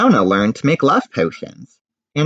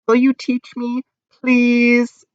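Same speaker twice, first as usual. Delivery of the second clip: put-on high pleading girl; 13 semitones higher, 4 dB louder, slower than usual. Tall girl begging to learn.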